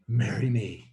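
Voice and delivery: lightly voiced gruff proposal; a hint of confident gruffness; gruff, confident suitor. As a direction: imitate a bold, gruff suitor. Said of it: "in his gruff, confident voice".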